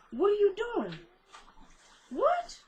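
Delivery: high-pitched off-mic questioning; real calling out. Real, not acted. Vlogger interrupting herself to question Jasmine, high calling out.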